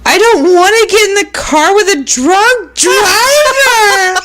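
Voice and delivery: high hammed-up bawling kid; imitating a wailing child, drawn-out and breaking into laughter; mock wailing screech. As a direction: screech it out between fake hysterical sobs. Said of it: "he manages to screech out".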